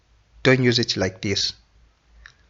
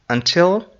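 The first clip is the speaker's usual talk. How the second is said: cheerful sign-off; 8 semitones higher than usual. Real, not acted. Teacher's sign-off.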